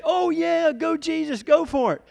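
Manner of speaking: high, loud hollow cheering; mock cheering crowd. Preacher voicing the fickle crowd, mock enthusiastic cheer. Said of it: "this fickle crowd, we're accepting him".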